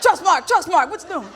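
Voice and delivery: high, loud mocking hail; mock calling out. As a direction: holler at someone by their job. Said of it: "What if I call y'all by y'all damn job?".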